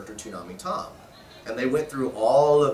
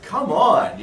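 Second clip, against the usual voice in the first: ordinary delivery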